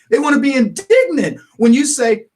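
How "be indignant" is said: loud, emphatic slip of the voice; voice change. Real, not acted. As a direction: voice jumping on indignant. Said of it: "Did you hear my voice change?".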